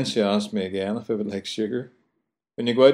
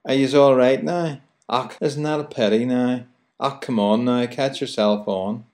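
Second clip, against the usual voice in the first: Belfast accent; 3 semitones higher than usual. Shown as a demonstration. Radio host demoing Belfast talk to listeners, broad Belfast accent. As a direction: show off Belfast chat, stressing now. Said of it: "that word now seems to come up in every other sentence".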